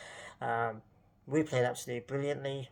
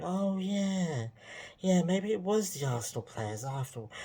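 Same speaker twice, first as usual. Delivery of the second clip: higher, dopey mock agreement; lightly hammed-up naive voice; mock gullible fan; 6 semitones higher than usual. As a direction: play the easily fooled fan. Mocking gullible fans.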